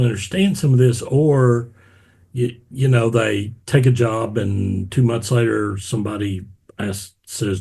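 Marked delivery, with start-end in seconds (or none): none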